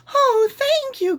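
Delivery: very high, slow, drawn-out thanks; delighted cry. A narrator's reading. Minnie thanking the dragon, delighted and high. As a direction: gush with joy. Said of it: "cried Minnie".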